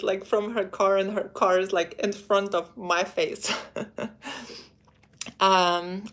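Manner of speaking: ordinary talk, nothing marked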